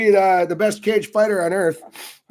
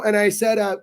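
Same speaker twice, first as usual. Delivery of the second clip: ordinary delivery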